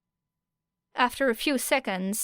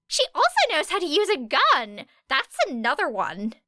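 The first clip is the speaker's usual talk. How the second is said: eager; 7 semitones higher than usual. A narrator's reading. Ashido voiced, eager.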